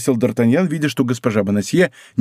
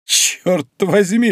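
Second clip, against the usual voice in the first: slow, higher exclamation of amazement; amazed exclamation; 5 semitones higher, much slower than usual. A narrator's reading. D'Artagnan reacts to the cardinal's money, amazed cry. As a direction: draw out the oath in wonder. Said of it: "вскричал Д'Артаньян".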